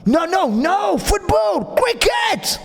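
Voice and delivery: mock Pakistani-English shout rising to "cricket"; barber's Pakistani English. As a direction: shout it like the barber correcting him. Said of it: "il me dit".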